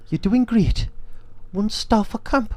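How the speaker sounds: mimicked TV presenter. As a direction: call it out high, hammed-up impression. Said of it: "That was pretty similar".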